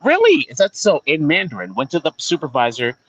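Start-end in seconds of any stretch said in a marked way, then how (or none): 0.0-1.0 mock surprise. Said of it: "she said to this bitch".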